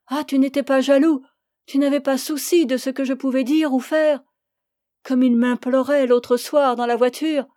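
reproche vif, un peu plus haut; amante pleine de reproche. Acted. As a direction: provoquer la jalousie de son amant. (amante amère et provocante)

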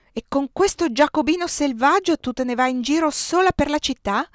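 loud, high scolding like a stern father; thundering rebuke. A narrator's reading. Brother scolding his sister like a father, thundering. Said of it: "tuonò Stefano".

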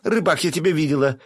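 громко и высоко кричать вдогонку; кричит скрывающемуся любовнику; крик. A narrator's reading. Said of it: "закричала Василиса". (громкий высокий крик вслед)

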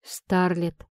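low, understated broken plea; mildly cracked male character voice; voice breaking. A narrator's reading. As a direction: say the name low, as if giving way. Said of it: "Его голос надломился".

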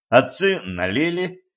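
usual speaking voice

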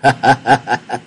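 put-on dry harsh old man's cackle; cackling laugh. A narrator's reading. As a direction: cackle in mockery. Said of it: "cười khằng khặc".

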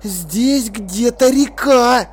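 slow hiss through gritted teeth. A narrator's reading. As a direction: force it out between clenched teeth. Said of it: "снова прошипел он сквозь стиснутые зубы".

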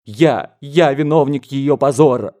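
loud, dramatic self-accusation; anguished theatrical outburst; tragic cry. A narrator's reading. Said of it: "трагическим голосом закричал дядя".

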